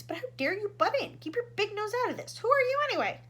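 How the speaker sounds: high, loud indignant protest. Acted. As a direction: protest the interruption. Shanks indignant at intruder.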